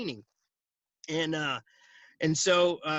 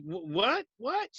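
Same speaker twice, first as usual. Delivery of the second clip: stammered, rising double question; second word leaps up in disbelief; startled; 4 semitones higher, slower than usual. Retold or imitated. Manager hearing her agent quit, startled. Manager shocked at his quitting.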